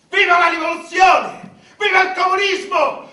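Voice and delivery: high, loud revolutionary cheering; rally shout. Acted.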